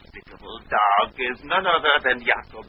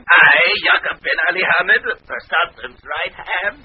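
indignant, high-pitched vizier; offended; 7 semitones higher, 5 dB louder than usual. Acted. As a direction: puff up in wounded pride, slowing at the end. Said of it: "don't get mad".

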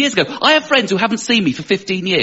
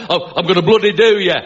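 aggressive Northern English threat; Northern accent; 3 semitones higher than usual. Acted. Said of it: "or like Northern accents".

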